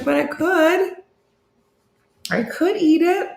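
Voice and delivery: high-pitched mock protest. Playful answer to being told no bagel, mock protest.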